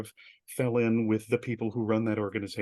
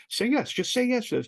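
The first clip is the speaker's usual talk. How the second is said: urging himself; 12 semitones higher, 4 dB louder than usual. Retold or imitated. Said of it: "And I'm like".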